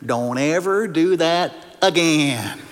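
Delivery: slow stern East Tennessee boss; a hint of a stern East Tennessee boss; East Tennessee accent. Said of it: "who has a very thick East Tennessee accent".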